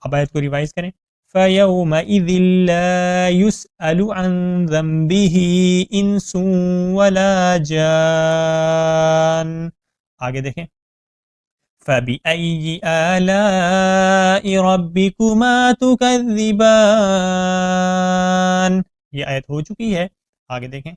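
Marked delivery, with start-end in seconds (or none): 1.3-9.7 slow melodic tajweed chant; Quran recitation. Shown as a demonstration. Revise the verse. 11.9-18.8 slow melodic tajweed chant; Quran recitation. Shown as a demonstration.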